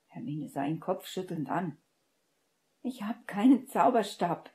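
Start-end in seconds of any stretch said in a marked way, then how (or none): 2.8-4.5 louder, pitched-up desperate cry. A narrator's reading. Wandless Hermine tells Severus in despair, louder. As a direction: plead in despair. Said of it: "sagte sie verzweifelt".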